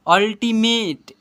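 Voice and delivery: अल्टीमेट loud and slow, opening on a; u read as a. Shown as a demonstration. Teacher shows pupils how it is read, slow a sound. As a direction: stretch अल्टीमेट syllable by syllable. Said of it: "ऐसे पढ़ेंगे".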